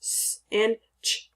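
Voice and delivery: "s" as a bare hiss, "ch" as a short puff; isolated letter sounds. Shown as a demonstration. Teacher introduces the day's letter sounds, isolated letter sounds. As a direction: sound out "s" and "ch" with no vowel after them. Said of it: "with the letter sounds".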